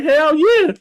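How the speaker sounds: high, eager hell yeah; mock eager agreement; eager yes. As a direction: jump at the offer. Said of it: "and she like".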